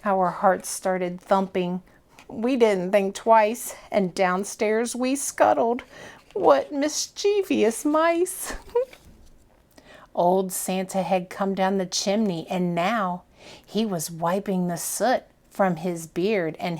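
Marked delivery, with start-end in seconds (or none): none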